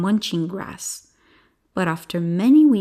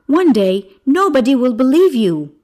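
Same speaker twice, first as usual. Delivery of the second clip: loud, raised stern warning; 6 semitones higher, 9 dB louder than usual. Acted. Villager warning the lying boy, forceful. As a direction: warn forcefully, much louder and higher. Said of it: "warned another villager".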